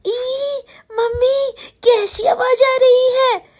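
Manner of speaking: put-on a small girl startled in the dark; high scared whimper, slow; scared child. Girl hears strange noise at night.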